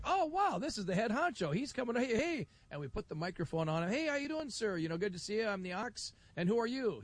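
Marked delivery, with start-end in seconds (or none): 0.0-2.5 high, eager oh-wow gushing; excited. Retold or imitated. Host spots the head honcho, gushing high and eager. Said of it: "we're like". 3.9-7.0 bright, high welcome into the mic; friendly on-air greeting. Retold or imitated. Host sticks the mic at the official with a high welcome.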